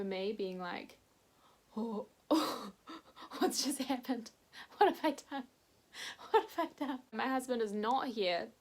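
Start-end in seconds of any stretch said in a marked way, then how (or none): 1.8-7.0 panicked dread. Retold or imitated. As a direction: let the panic climb line by line. Dread washing over her.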